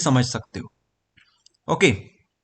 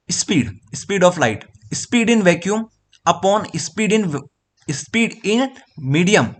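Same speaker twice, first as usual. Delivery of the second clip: slow dictation; 3 semitones higher, much slower than usual. Shown as a demonstration. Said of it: "सी मतलब".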